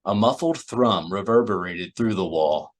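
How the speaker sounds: plain reading voice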